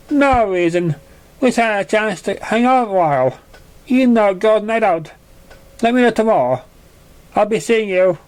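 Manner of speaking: casual and cheerful, a friend inviting; lightly voiced friendly pony. A narrator's reading.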